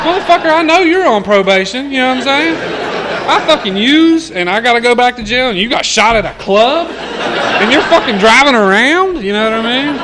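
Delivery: loud, high-pitched comic rant; incredulous outrage. Retold or imitated. Man on probation reacting to shot ex-cellmate, incredulous retold rant. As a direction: rant in disbelief, voice pushed way up.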